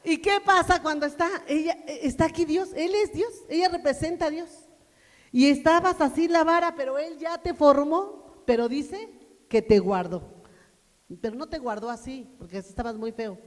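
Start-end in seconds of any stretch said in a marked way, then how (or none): 0.0-10.2 louder, higher preaching push; full-force preaching. Acted. Preacher drives the point home to the church, loud and higher.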